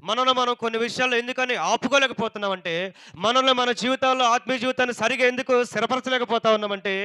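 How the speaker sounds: loud sermon delivery, pressing each point; forceful pulpit exhortation; impassioned preaching. Real, not acted.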